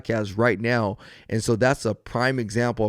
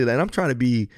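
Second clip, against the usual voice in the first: ordinary talk, nothing marked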